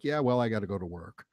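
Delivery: low, offhand brush-off; lightly mocked shrug in the voice; mock indifference. Imitating indifferent people brushing him off.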